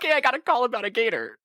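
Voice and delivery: a hint of a breezy gator expert; imitating Brenda. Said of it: "She should have just been like".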